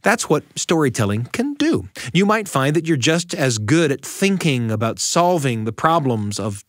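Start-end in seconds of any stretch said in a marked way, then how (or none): none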